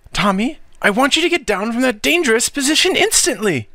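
high, insistent command; firm old aunt, barely holding her nerves; stern anxious aunt. Acted. Aunt orders boy down.

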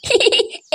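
high-pitched giggle; short high snicker. Acted. Cartoon character's own giggle.

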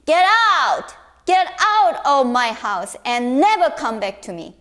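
loud furious father; father yelling his son away; angry shout. Acted. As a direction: banish him in anger. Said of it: "his father was so angry".

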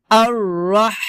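আর রাহ ending in a long thin stream of air; airy throaty ha. Shown as a demonstration. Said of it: "মধ্যখান থেকে আপনাকে বাতাস বের করতে হবে".

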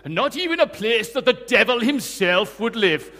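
loud, poorly imitated Nigerian accent; bad Nigerian accent. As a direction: have a clumsy go at a Nigerian accent. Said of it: "I won't do her accent very well".

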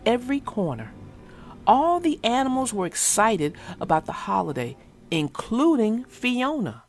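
gentle read-aloud for children, voicing each animal; story narration. A narrator's reading. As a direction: tell it like a bedtime storybook.

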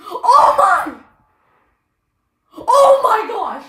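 slow, loud, high cry of joy; half laughing, half crying with delight; thrilled cry. Real, not acted. Kid shrieking at a big pull.

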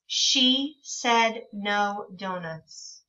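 very slow and deliberate; slow modeled reading. Shown as a demonstration. Teacher reading a page slowly to children. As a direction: stretch every word for young readers. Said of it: "So it says".

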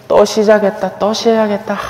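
a hint of a fed-up parishioner; grumbling listener. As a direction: sigh it out like he's droning again.

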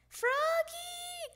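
put-on father summoning his child; calling out. A narrator's reading. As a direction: holler the name as if across the house. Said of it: "called his father".

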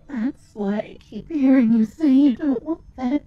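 loud accusing outburst; shouting in bitter anger; angry shout. Acted. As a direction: throw the accusation back hard. Confronting an unwanted voice.

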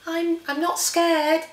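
a hint of a high, slow nervous child; lightly voiced scared child. The Gruffalo's child to herself, a light nervous child voice. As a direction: say it trying to sound brave.

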